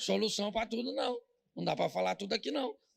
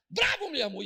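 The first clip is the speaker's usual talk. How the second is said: loud, much higher burst; emphatic shout; 8 semitones higher, 6 dB louder than usual. Real, not acted. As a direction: shout the praise out. Praising the fierce uncle. (gritando com ênfase)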